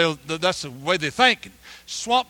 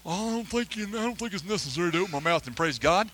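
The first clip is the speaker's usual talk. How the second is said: reluctant worshipper; 5 dB quieter than usual. Acted. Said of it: "you come here and you stand around".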